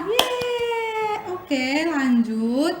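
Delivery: put-on bright, sing-song voice for small kids; reading to a toddler. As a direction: coax the little ones along. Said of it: "quizzing children on animals".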